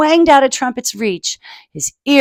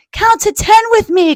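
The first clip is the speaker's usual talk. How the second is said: coughing mother; 12 semitones higher, 5 dB louder than usual. Acted. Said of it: "coughed Missus Trumpet".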